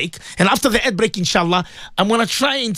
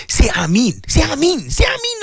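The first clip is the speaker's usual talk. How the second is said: each Ameen pitched higher, climbing to very high; rising call; 6 semitones higher than usual. Real, not acted. Preacher urging listeners to answer Ameen, climbing call. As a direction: push the call up a step each time.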